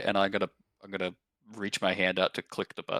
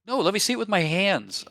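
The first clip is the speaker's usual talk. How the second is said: high, louder protest; insistent protest; 12 semitones higher, 6 dB louder than usual. Acted. Player insists on handling the rod, insistent protest. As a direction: push back hard, pitch jumping up. Acted tug over the rod.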